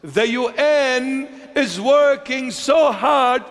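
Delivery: high, drawn-out mock announcement; mock-grand proclamation. Preacher ridicules UN working for peace, mock-grand proclaiming. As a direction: stretch it out with sarcastic pomp. Mocking the UN's claim of peacemaking.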